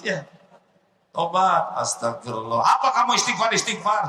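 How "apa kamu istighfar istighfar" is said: mocking boss. Boss mocks employee's istighfar.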